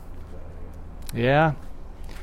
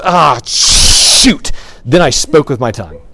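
comic dismay; 7 dB louder than usual. Retold or imitated. David's comic slip, loud.

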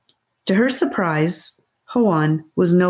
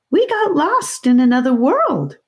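put-on earnest boy; 9 semitones higher than usual. A narrator's reading. Boy explaining himself.

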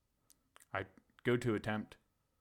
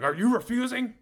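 much higher, louder "are you refusing"; demanding cop; 13 semitones higher, 9 dB louder than usual. Retold or imitated. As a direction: bark it like an angry cop.

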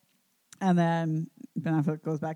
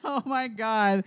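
laughing; 4 semitones higher, much slower than usual. Real, not acted. Laughing at awful scene.

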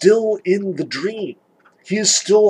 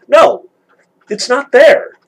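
louder, higher, firm denial; emphatic refusal, louder and higher; 6 semitones higher, 6 dB louder, slower than usual. Real, not acted. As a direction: slam the door on the idea. Cutting off a false reading.

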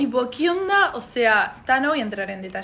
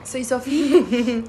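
drawn-out self intro; 5 dB louder, much slower than usual. Acted.

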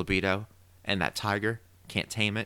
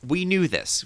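KNEW jumps high and loud; excited emphasis; 10 semitones higher, 4 dB louder than usual. Real, not acted. Host bragging to his co-host, stressing "knew". Gloating about a called prediction.

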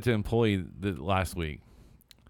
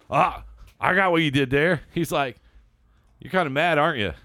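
teasing employee; 8 semitones higher, 8 dB louder than usual. Retold or imitated. Employee needling boss in retold exchange.

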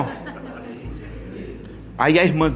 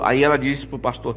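ordinary talk, nothing marked

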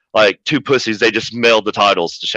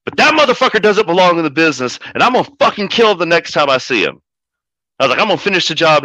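retold angry threat; 6 semitones higher, faster than usual. Threatening rival in retold scene.

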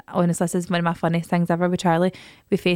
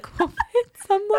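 put-on high child squeak; 13 semitones higher than usual. Quoting child.

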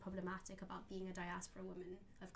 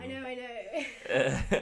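laughing; 4 semitones higher, 17 dB louder, much slower than usual. Real, not acted. Amused agreement after a joke.

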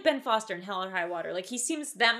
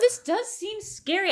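mock spooky; 11 semitones higher, 6 dB louder, slower than usual. Mock spooky reaction to Batman.

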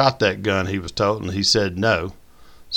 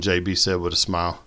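ordinary delivery

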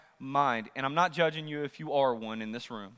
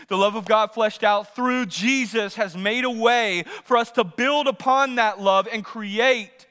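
higher, driven preacher's voice; full-force preaching; 7 semitones higher, 9 dB louder than usual. Acted. Preacher asking the congregation what homes are built on, full-force preaching. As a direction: preach it hard, hammering the key words.

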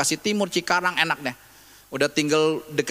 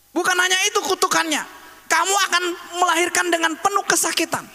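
raised-pitch sermon delivery with pauses; forceful preaching; 9 semitones higher than usual. Real, not acted. Preacher to mothers about painful pregnancy, forceful preaching. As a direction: press each point home in a high preaching voice.

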